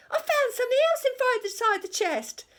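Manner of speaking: very high, loud and fast; a pirate bursting with news; excited pirate. A narrator's reading. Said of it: "she cried".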